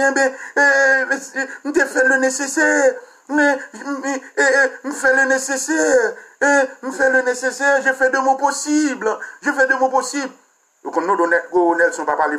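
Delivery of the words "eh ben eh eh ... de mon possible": high, slow, whiny mock minister repeating himself. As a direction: drag it out like a pleading official. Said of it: "kon y a minis nan di". (yon ti vwa minis k ap plenn tou dousman)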